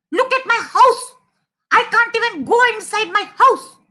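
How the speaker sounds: high, indignant little mouse voice; angry mouse. Acted. Angry mouse telling off a messy elephant, high and indignant. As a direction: scold in a high, cross voice. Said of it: "This mouse is very angry".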